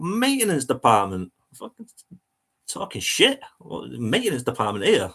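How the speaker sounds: high disbelieving sneer, peaking on Fucking; incredulous scoff. Retold or imitated. Reading the host's reply, a high incredulous scoff. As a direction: scoff like it's the daftest thing you've heard.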